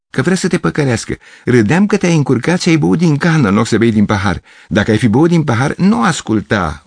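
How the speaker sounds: put-on high, laughing girl's voice; mock him like a giggling girl. Girl laughing at Gavrilescu, high and mocking.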